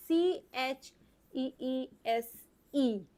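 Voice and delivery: एस एच ए पी ई spelled very slowly; slow spelling. Shown as a demonstration. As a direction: drag each letter out for the child. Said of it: "teacher spells letters slowly". (बहुत धीरे अक्षर बोलना)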